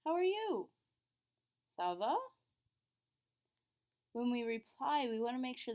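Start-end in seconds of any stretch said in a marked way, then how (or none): 0.0-0.7 "How are you?" said high and loud as a question; English "How are you?" with a question lift; rising question. Shown as a demonstration. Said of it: "same thing in English". 1.7-2.2 "Ça va?" asked with a lift at the end; "Ça va?" as a question; rising question. Shown as a demonstration. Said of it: "our voice goes up at the end".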